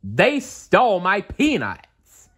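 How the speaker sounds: cartoon duck sulking slowly; angry Donald. A narrator's reading. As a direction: drag out the grievance, sulking mad. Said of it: "said Donald angrily".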